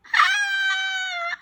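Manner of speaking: very high, drawn-out scream. A narrator's reading. Boy sees the ghost's face, high scream. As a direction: shriek in terror. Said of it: "I screamed".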